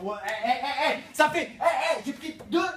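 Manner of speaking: loud, twitchy, sleepless rant; jittery and wired. Acted. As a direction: buzz like three weeks without sleep. Sleepless caffeine addict ranting.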